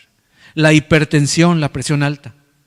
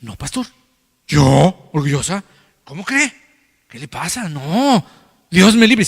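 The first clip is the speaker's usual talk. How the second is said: mock-indignant proud woman; hammed-up outraged churchgoer; offended denial; 4 semitones higher than usual. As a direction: act out a scandalized denial, climbing higher. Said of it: "lo primero que va a decir".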